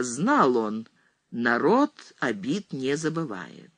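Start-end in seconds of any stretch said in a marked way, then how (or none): none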